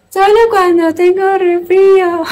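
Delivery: high, slow, squeaky mock voice reading the comment. Streamer rereads a viewer's comment in a high, slow, teasing squeak. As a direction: squeak it out slowly to tease the chatter. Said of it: "Al final le pone".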